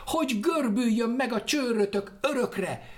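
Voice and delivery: high, loud furious curse; angry curse. Acted. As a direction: hurl the curse in rage. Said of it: "kiáltott fel haragjában". (haragos, magas, hangos átok)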